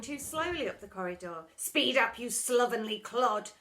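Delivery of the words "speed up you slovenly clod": louder barked insult; scolding teacher. Acted. Miss Seeth hurrying pupil, scolding. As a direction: spit the insult out. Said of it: "she'd poke them".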